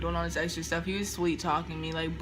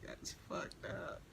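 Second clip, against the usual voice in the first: whisper; 16 dB quieter, much slower than usual. Real, not acted. Aside to the viewers.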